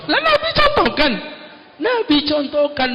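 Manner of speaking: of course, the Prophet showed it; emphatic exclamation. Real, not acted. Preacher answers the doubter, emphatic and surprised.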